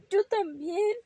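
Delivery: high, weepy little rabbit voice; acted child's sob while agreeing; tearful. Ramón still tearful after confessing.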